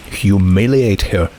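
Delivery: plain reading voice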